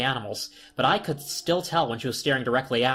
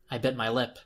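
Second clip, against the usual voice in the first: ordinary delivery, nothing marked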